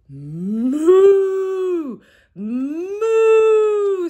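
high, loud moo; mooing hen. Acted. Teacher voicing Hen, loud mooing. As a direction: moo like a cow. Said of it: "says Hen".

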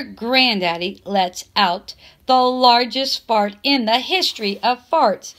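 plain reading voice

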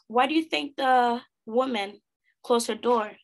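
ordinary delivery, nothing marked

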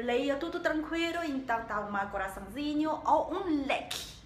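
ordinary talk

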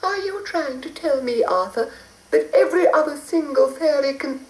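high hurried fairy; a sweet high fairy in a rush. A narrator's reading. Fairy dismayed.

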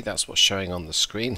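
normal talking voice

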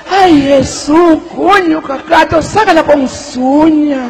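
slow desperate plea. Retold or imitated. Praying the spider leaves.

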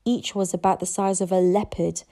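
ordinary delivery, nothing marked

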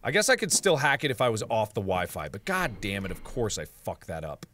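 usual speaking voice

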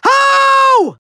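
very high, stretched villain cry; exasperated wail. Acted. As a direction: wail it in theatrical frustration. Villain wails at failure.

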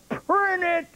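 high-pitched mock call of a pleased director; director's call. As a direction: call the take done like a delighted director. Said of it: "and he goes".

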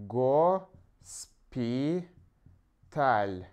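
"госпиталь" split slowly as go - spi - tal, with long gaps; slow broken-up "госпиталь" for writing down; syllable by syllable. Shown as a demonstration. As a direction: dictate "госпиталь" one syllable at a time. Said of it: "you also can put here".

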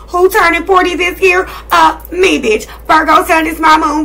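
high, loud bragging; bragging to viewers, loud and high; proud brag. Real, not acted. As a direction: show off to the viewers. Bragging about her age.

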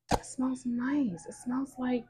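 slow, soft whisper while sniffing; savoring a smell. Real, not acted. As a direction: breathe it out, savoring the scent. Sniffing a new candle.

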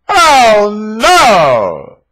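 hammed-up "Oh, no!", high and loud with a cry; mock horror. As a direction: gasp it like a melodrama victim. Mock horror at his own reveal.

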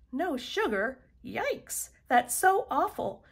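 high-pitched, exclaiming dismay. A narrator's reading. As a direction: sound appalled for a faraway friend. Child's dismayed letter read aloud.

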